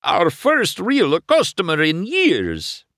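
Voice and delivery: high, slow Italian glee; excited Italian shopkeeper. Acted. Luigi delighted by his first customer, Italian accent. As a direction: savour each word like a proud Italian.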